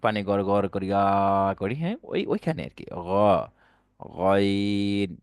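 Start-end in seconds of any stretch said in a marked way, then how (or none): 2.9-5.2 গইন held very long, gargled in the throat; গড়গড়া করে গইন; correct ghain. Shown as a demonstration. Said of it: "গড়গড়া".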